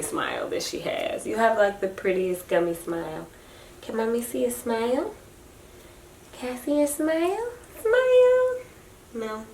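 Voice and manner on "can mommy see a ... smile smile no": higher and softer, coaxing. Real, not acted. Mother coaxing her baby girl to smile. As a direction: gently coax a smile.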